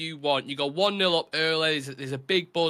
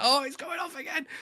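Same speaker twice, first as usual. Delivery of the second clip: excited; 12 semitones higher than usual. Retold or imitated. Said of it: "You're like".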